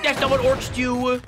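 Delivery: mock orc purist. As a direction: protest like an outraged fantasy pedant. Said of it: "and be like".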